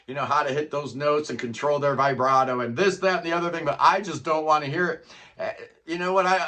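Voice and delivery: ordinary talk, nothing marked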